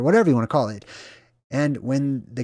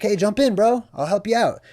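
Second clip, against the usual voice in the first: friendly boat rescuer; 7 semitones higher than usual. Retold or imitated.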